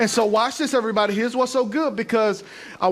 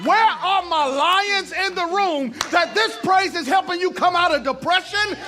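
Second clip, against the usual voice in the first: full-force preaching; 8 semitones higher than usual. Acted.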